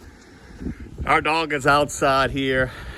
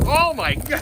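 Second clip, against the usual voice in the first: amazed; 12 semitones higher than usual. Real, not acted. Amazed by heavy snowfall.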